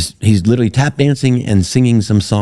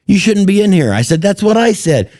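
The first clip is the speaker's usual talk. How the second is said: mock argument; 13 semitones higher than usual. Back-and-forth quarrel retold.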